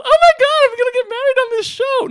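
high, loud squealing contestant voice; mock giddy bride. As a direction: squeal it like an overexcited woman seeing a ring. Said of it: "they go".

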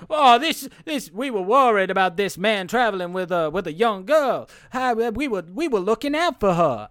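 high, hammed-up Southern protest; Southern accent. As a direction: plead innocence in a put-on Southern accent. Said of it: "and the guy's like".

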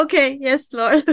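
high, loud, laughing yes; laughing reply. Retold or imitated. Guest retelling her answer to God, laughing hard. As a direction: gasp it out through laughter, giddy.

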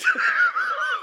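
high, loud sarcastic burst of laughter; mock laugh. Mocking Guy's potato joke with a high, loud fake laugh.

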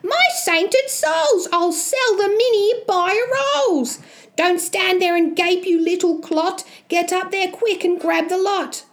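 high-pitched, greedy mother crying out; excited yell. Acted. Mother seeing gold and ordering Jack up, high excited cry. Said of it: "She yells out loud".

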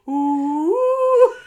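loud high eerie woo; alien voice. Pretend. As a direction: call out like a UFO alien. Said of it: "No, don't a woo to it".

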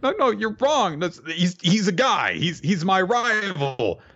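put-on high, rattled protest of a flustered teen boy; flustered denial. Son flustered, denying his rival is a girl, panicky high voice. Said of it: "And Ninokuru is like".

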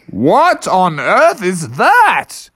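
slow, swooping high disbelief; astonished Father Bear. Acted. Father Bear sees a horned animal, astonished. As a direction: stretch each word in amazement.

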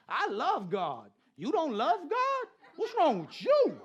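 high, loud, slow disbelief; hammed-up preacher outrage; mock incredulous shout. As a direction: act shocked anyone doesn't love God. Retelling preaching at seatmate.

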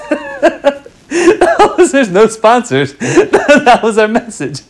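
laughing through the words; breaking up with laughter. Real, not acted. Joke about fake sponsor break.

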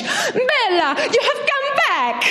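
high, quick hammed-up Italian charmer; a lightly put-on Italian charmer voice; Italian tour guide. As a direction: greet her like a delighted suitor. Tour guide reappears, Italian man.

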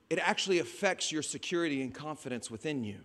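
normal talking voice

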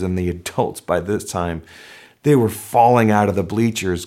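normal talking voice

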